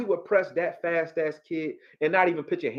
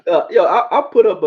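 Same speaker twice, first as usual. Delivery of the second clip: laughing; 6 semitones higher, 10 dB louder than usual. Real, not acted. Laughs while starting story.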